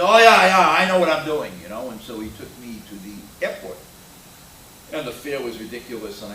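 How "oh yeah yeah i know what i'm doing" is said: loud, high brush-off; dismissive cabbie. Retold or imitated. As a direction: wave off the passenger's doubt.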